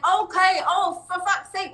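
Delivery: mock rage at the symptom; exasperated. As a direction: vent at the bad timing. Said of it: "feel the rage".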